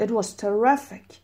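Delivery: slow teacher model reading; slow textbook dialogue; slow model sentences. Shown as a demonstration. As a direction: read it slowly and clearly for learners. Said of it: "مثلاً سفرتون چطور بود؟".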